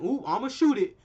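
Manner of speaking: mock cocky character. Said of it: "and she went".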